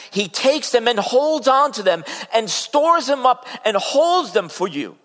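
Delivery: high, loud, driving sermon voice; full-force pulpit preaching; fervent preaching. Acted. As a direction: preach it out with mounting fire.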